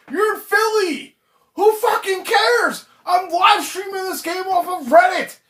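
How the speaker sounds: high, loud furious rant at the manager; real fury over a bad call; angry rant. Real, not acted. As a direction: yell it out in disbelief. Ranting at the manager, shouting.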